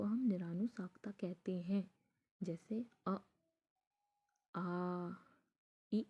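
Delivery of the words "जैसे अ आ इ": अ आ इ said alone, air only through the mouth; अ, आ, इ said one by one; oral vowel demo. Shown as a demonstration. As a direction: model each vowel for students to copy. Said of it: "इसमें जो आप बोल रहे हैं ना".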